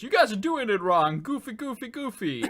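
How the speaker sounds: hammy rubber-faced comic voice; Jim Carrey impression. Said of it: "he goes".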